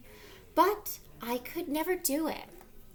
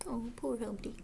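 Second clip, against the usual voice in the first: 1 soft sympathy; 6 dB quieter, faster than usual. Real, not acted. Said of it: "sympathetic aside to children".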